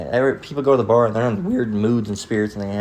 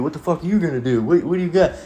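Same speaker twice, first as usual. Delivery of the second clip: higher, altered tough-guy taunt; mocking tough guy; 6 semitones higher than usual. Skinhead taunting the dad, higher put-on tough voice. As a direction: sneer it like a bar thug. Thug challenging dad at door.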